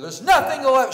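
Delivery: high, loud mock-stern outburst; acted-out indignant yell; scolding shout. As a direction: shout it at a bunch of giggling kids. Marching up to scold giggling boys.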